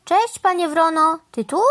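a touch of surprise; surprised greeting. A narrator's reading. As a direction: greet an unexpected visitor. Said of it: "zdziwiła się Mama Mu".

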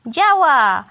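loud, drawn exclamation; triumphant call. A narrator's reading. Crow watching the fan work, triumphant call. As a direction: call out that the trick succeeds. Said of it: "zawołał pan Wrona".

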